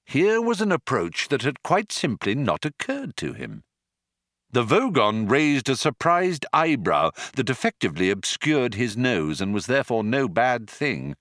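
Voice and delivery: usual speaking voice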